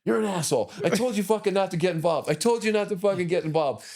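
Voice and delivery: high, loud imitated wife's tirade; wife yelling. Wife rants at injured husband on ride home, high and loud. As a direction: pitch way up and rant at him. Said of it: "Cindy's like".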